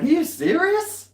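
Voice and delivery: high, loud stunned "are you serious"; amazed disbelief. Retold or imitated. Retelling the chef's email, stunned and thrilled. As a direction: squeal it out in happy disbelief. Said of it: "I was like".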